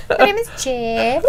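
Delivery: stilted fake Mexican Spanish accent; awkward comic Spanish accent; mock Spanish accent. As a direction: imitate a bad Spanish accent, ending on a rise. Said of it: "You're like the Mexican Wolverine".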